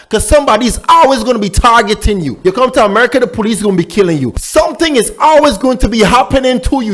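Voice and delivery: normal talking voice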